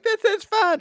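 very high, gleeful mock voice; retold delight. Woman having a great time on the roller coaster, delighted. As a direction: squeal it like a thrilled rider. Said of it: "she's having a great time".

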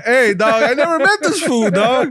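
high, laughing disbelief; laughing protest. Real, not acted.

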